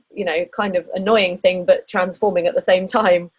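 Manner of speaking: normal talking voice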